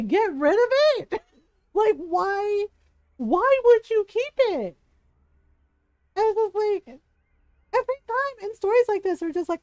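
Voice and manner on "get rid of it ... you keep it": exasperated. Real, not acted. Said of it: "frustrated at game character".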